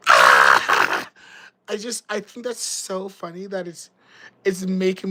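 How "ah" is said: a sudden low, loud "Ah!" of a laugh; a single hammed-up cackle; mock shouted laugh. Shown as a demonstration. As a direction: blurt out one big fake guffaw. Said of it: "I'm not gonna laugh like".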